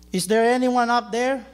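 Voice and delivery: high, loud desperate shout up the cliff; man hanging from a branch yells for help; calling for help. Acted. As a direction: yell up from far below for rescue. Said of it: "Jack cried".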